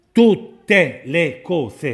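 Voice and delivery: "Tutte le cose" syllable by syllable, loud and high; very slow, forceful, separated "Tutte le cose"; hammered emphasis. Real, not acted. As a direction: punch out each beat of "Tutte le cose". Preacher hammers promise syllable by syllable.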